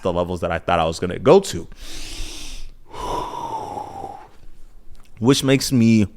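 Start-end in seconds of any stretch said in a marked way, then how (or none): 2.9-4.1 soft, falling exhale; sigh. Real, not acted. Pausing before rethinking training, soft sigh. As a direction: let out a soft breath.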